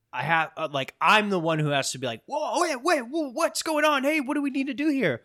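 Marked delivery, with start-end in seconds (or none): 2.2-5.2 high, urgent alarm at a sudden crisis; alarmed husband. Retold or imitated. Husband rushing to a panicked wife, high and urgent. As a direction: jump in like something's gone badly wrong.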